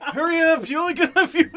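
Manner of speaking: put-on high, loud laughing old geezer; old man voice. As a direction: urge like an eager old man. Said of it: "like the old dude from, uh, Family Guy".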